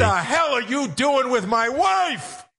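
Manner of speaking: high, slow squawking outrage; hammed-up outraged husband impression; Gilbert Gottfried. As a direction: stretch it out in a high put-on squawk. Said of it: "my Gilbert".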